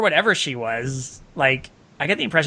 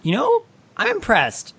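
mock impressed; 11 semitones higher than usual. Real, not acted. Sarcastic praise.

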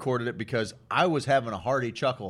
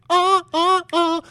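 loud squealing high oh; high falsetto oh; 9 dB louder, slower than usual. Pretend. Ends falsetto bit.

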